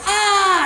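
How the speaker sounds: high, loud yelped scream; mock scream. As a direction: burst out with a wild, high yell. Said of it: "don't scream".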